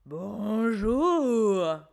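a hint of a monster voice; monster greeting. A narrator's reading. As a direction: greet them as a playful monster. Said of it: "dit Martin, le monstre".

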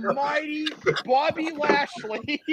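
slow, high, grandiose promo call; big drawn-out manager's introduction; Paul Heyman. Acted. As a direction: draw out the wrestler's name grandly. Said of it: "Paul Heyman, that's hilarious".

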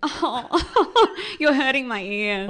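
high, laughing "oh, you're hurting my ears"; laughing mock complaint. Guest recalling her reaction, laughing mock pained. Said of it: "I'd just be like".

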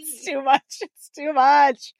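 laughing through tears; overwhelmed, drawn-out and laughing; touched. Real, not acted. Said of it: "I'm getting emotional".

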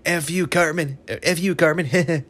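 high-pitched imitated cartoon comeback; Butters. Retelling a friend flipping him off, high mock insult. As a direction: mimic a character cursing back in a high voice. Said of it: "then Butters flips him off".

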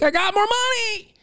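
very high, gleeful cry; high mock glee; thrilled. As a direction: squeal over found money. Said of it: "you're like".

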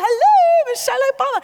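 high, loud, fast starstruck hello; excited mock hello; starstruck greeting. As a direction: greet her like a giddy fan.

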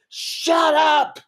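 ferocious high-pitched scream; Bob Goldthwait. Retold or imitated. Comic imitating angry performer silencing laughing crowd, ferocious scream. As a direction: scream it at full force, very high. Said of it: "Like, scream".

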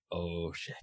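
soft, low mock dismay under the breath; muttered under breath. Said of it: "And I was just like".

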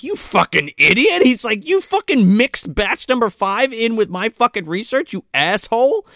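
high-pitched mock rage at the director; angry rant.

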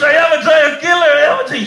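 loud, high triumphant boast; proud boast. Retold or imitated. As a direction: puff up and proclaim it like a champion. Proud church-goer boasting loudly.

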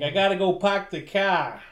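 mock nasal Boston accent. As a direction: drop the r's like a Bostonian.

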